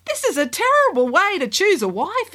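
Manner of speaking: high, indignant exclamation; the prince protests the test; exclaiming. Acted. Prince to queen, exclaiming in protest. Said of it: "exclaimed the prince".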